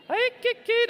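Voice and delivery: very high, loud yelping; fox call. Pretend. Dad joking about what the fox says, high yelps. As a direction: squeal out a silly fox cry. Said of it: "But what does the fox say?".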